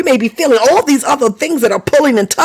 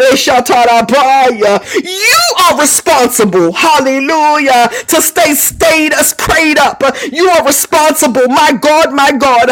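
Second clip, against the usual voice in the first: preaching; 3 semitones higher, slower than usual. Real, not acted. Tongues and preaching at full force.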